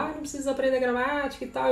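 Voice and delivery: quick, high-pitched mimicry of naysayers; dismissive student quote. Mimicking people who say grammar is unnecessary, high mock dismissal. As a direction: imitate people brushing off grammar, fast and high. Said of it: "Tem gente que fala".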